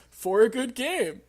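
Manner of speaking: slow, loud, high slogan read; slogan announcer. Acted. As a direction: sell the slogan like an ad. Quoting the studio slogan.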